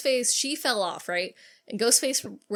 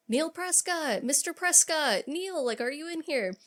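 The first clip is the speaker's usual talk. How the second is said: calling out; 7 semitones higher than usual. Retold or imitated. Dewey searching the house for Neil, higher calling voice.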